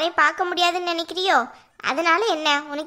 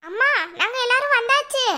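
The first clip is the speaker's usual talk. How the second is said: high-pitched call to mum; 6 semitones higher than usual. Acted. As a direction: call into the house from the doorstep. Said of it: "இப்படி கத்திட்டு நிக்க".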